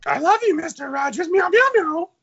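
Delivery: loud high puppet voice with meows; cat puppet voice. Pretend. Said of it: "I was gonna do my cat voice for you".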